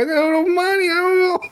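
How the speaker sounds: put-on very high squeaky baby whine, a bit loud, breaking into laughter; weird baby voice. Diaper guy pleading to the cleaning girls, squeaky baby whine. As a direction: squeak it out like a helpless toddler. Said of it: "weird baby boy".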